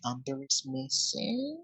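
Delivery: slow, hushed rumour whisper; very soft, drawn-out stage whisper. Acted. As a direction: pass it on as a secret whisper. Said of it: "whisper campaign going through".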